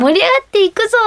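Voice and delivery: high, bright rallying call; concert hype shout. Retold or imitated. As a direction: show the line she can say. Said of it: "は言えるんです".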